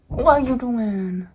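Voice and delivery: slow, loud mock-stern mom; imitating mom, exaggerated and slow. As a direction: ham up a nagging mom. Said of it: "she didn't say it like that".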